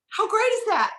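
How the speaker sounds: high, loud burst of delight; excited. Retold or imitated. Telling mom and dad about the commission, thrilled high and loud. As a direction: share the big news with your parents, thrilled. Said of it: "I'm like".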